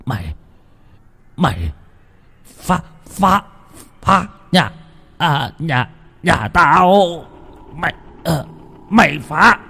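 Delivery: high, broken, panicked stutter; stammering in terror. A narrator's reading. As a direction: choke the words out in fright. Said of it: "âm thanh từ cổ họng của ông phát ra". (lắp bắp vì sợ hãi)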